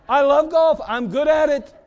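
loud, high-pitched crowing; playful boast. Real, not acted. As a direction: brag it out loud for laughs. Jokey public boast about golf.

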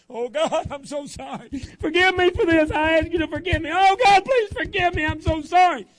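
very high, very loud begging cry; hammed-up wailing prayer. Teen back at the revival altar begging God, loud wail. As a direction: throw yourself at the altar and howl for forgiveness.

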